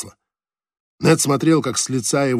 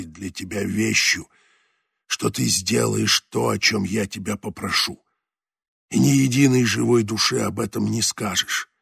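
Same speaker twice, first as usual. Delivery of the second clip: dying skipper; 3 semitones lower than usual. A narrator's reading. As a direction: speak like a dying man holding on. Voices dying skipper Leclerc.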